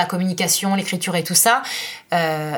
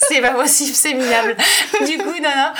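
higher, laughing disbelief; amused dismay; 7 semitones higher than usual. Real, not acted. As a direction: pretend despair, laughing. Mock despair at his spelling. (fausse consternation en riant)

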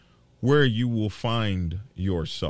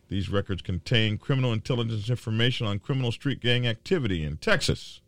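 ordinary talk

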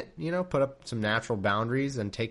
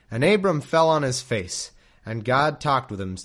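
louder, formal King James reading; scripture reading; 5 dB louder than usual. A narrator's reading. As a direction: read it like solemn scripture. Reads scripture aloud.

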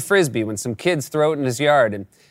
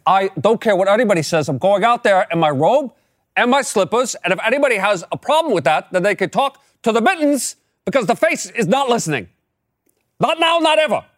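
high, emphatic old-man impression; Bernie-like cranky grandpa; 7 semitones higher than usual. Grandpa defying family over his bathrobe, hammed-up cranky impression. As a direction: ham up a stubborn old man, pitch climbing. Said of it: "the energy of a grandpa".

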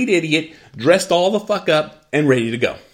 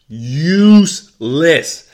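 Useless stretched long and louder; drawn-out loud repeat; 3 semitones higher, 8 dB louder, much slower than usual. Real, not acted. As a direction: hammer Useless home, very slow. Repeats the word for emphasis.

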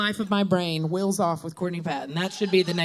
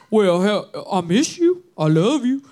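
louder, swooping Southern drawl; Pop Pop's Mississippi accent; 5 dB louder than usual. Acted. Granddaughter doing Pop Pop's fond words, loud Mississippi accent. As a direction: gush in a thick Mississippi accent. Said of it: "hold on, I'm gonna go into it".

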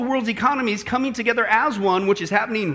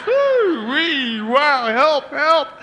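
high, playful whoop and plea; comically overwhelmed, pretending distress; mock cry for help; 4 semitones higher, much slower than usual. Pastor working with these interns, mock-desperate cries. Jokingly begs rescue from interns.

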